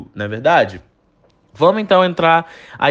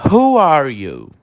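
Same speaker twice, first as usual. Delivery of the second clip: slow, clear English model phrase; teacher modelling slowly for repetition; slow model sentence; 3 dB louder, slower than usual. Shown as a demonstration. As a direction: say it slowly so students can repeat. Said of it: "Repete a pronúncia comigo".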